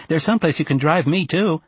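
put-on high-pitched boy angling for a favor; wheedling child. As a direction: pitch it up, hopeful and pushy.

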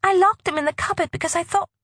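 high, fast, breaking off. Acted. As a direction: rush then choke on the words. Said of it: "her voice broke".

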